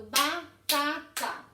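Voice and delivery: "batata" split into three separate beats, ba, ta, ta; syllable by syllable. Shown as a demonstration. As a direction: sound out "batata" slowly with a clear gap after each syllable. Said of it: "separação de sílabas".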